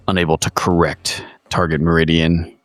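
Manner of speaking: ordinary talk, nothing marked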